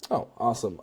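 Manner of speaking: usual speaking voice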